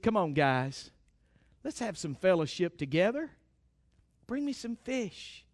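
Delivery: Jesus gently invites his disciples to eat; gentle Jesus. Acted. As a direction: call them kindly to breakfast. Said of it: "But that's not the heart of God".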